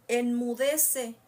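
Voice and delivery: loud, slow command; reprensión. Acted. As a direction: draw out the order to be silent. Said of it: "le dijo". (orden fuerte y lenta)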